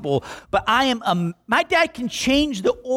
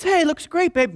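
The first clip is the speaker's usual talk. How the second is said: praising wife; 9 semitones higher than usual. Retold or imitated. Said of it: "your wife goes".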